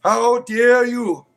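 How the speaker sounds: high, slow indignant shout; outraged zealot. Acted. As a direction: hurl it at the heretic. Zealot raging at a heretic.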